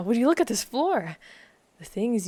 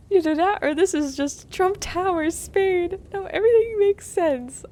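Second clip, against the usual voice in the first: high, laughing, breaking up; can barely speak for laughing; laughing through words; 11 semitones higher, 4 dB louder than usual. Real, not acted. Cracking up over Trump Tower.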